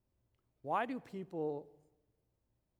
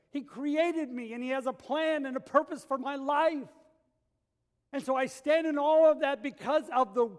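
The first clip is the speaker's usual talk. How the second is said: loud, rising sermon fervor; full-force preaching; 5 semitones higher, 8 dB louder than usual. Acted. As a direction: preach it with mounting passion. Preaching climax.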